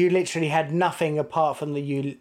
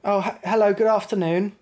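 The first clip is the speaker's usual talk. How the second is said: ordinary talk, nothing marked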